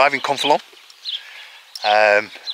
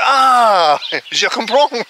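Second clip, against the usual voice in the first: mock French worker; 9 semitones higher, 4 dB louder, much slower than usual. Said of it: "and he went".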